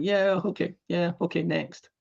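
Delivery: soft, high offhand acknowledgement; mock unimpressed winner; blasé. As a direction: brush the praise aside, ready to move on. Winner shrugs off award.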